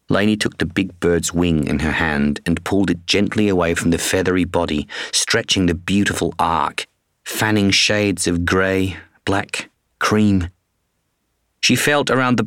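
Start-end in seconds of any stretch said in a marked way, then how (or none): none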